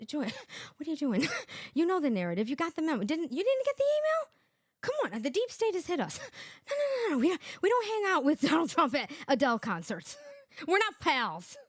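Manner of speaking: hammed-up host scolding her co-host; mock Meredith, exasperated. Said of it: "She, like".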